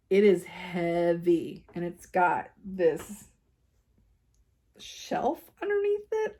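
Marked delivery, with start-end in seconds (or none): 4.8-6.4 slow, high-pitched questioning tone; unsure questioning. Real, not acted. Describing an odd desk part, high unsure questioning. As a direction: stretch it out high, as if unsure of the word. Unsure what to call the shelf.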